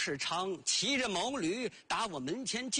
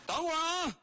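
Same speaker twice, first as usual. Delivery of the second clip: higher call back to the gate; 9 semitones higher, faster than usual. Acted. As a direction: shout to the visitor to wait. Answers the caller from inside. (老头朝院外高声应道)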